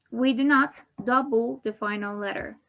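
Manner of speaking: ordinary delivery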